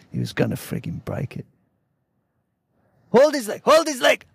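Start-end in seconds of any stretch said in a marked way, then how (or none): 3.1-4.2 much higher, calling out to his mates; chef shouting. Retold or imitated. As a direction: yell the order to grab the leg. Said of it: "shouting for his mates".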